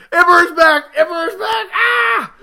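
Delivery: high, loud repeated shout; texting friends, shouting the news in excitement; excited shouting. Retold or imitated. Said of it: "furiously texting everybody".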